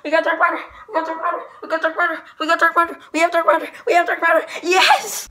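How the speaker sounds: high, loud triumphant yelling; excited screaming. Real, not acted. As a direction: scream with joy at the win. Got Darth Vader camo, celebrating.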